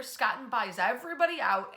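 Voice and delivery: normal talking voice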